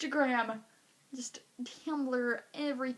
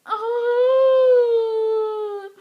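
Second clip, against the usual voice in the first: high, loud drawn-out "Oh"; sympathetic wail; 9 semitones higher, 15 dB louder than usual. Retold or imitated. Girl to sad friend, loud pitying wail. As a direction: let a pitying cry out.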